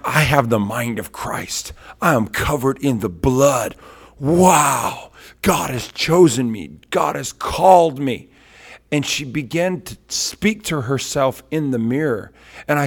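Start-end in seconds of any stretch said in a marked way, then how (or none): 0.0-8.3 higher and louder, slow and proud; mock-proud child proclaiming, a bit higher; bold affirmations. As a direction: declare it like a girl finding her worth. Daughter's affirmations in the mirror.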